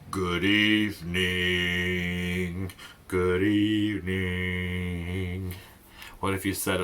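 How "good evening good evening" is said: drawn-out mock grump; low, tired, grumpy. Shown as a demonstration. Teacher shows kids a tired, grumpy good evening, low and dragging. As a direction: drag it out low and weary. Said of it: "down really low, like you were just kinda tired and grumpy".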